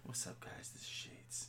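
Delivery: whispered show greeting; barely voiced hello to listeners; hushed intro. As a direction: introduce yourself in a very soft whisper. Handed the mic, introduces himself hushed.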